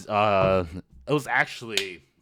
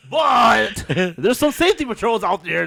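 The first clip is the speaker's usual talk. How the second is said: loud; 8 semitones higher, 6 dB louder than usual. Real, not acted. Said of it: "You're talking too loud".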